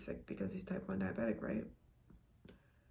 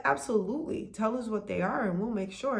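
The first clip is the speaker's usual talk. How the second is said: lightly acted higher, louder staff reply; accommodating restaurant staffer; 4 semitones higher, 7 dB louder than usual. Restaurant staff answering a dietary request, accommodating. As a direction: imitate an eager-to-help phone voice.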